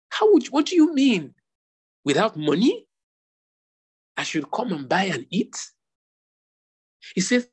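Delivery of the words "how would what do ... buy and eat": high-pitched, incredulous challenge on "what do you mean"; baffled questioning. Acted. Baffled listener challenges the invitation to buy without money, incredulous. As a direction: question it in mock disbelief, rising at the end. Acts out baffled reader of scripture.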